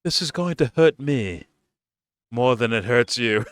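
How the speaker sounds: hammed-up sinister reassurance; mock villain line. Vlogger mocking Sauron's line to his prisoner, mock villain. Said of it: "it comes off as".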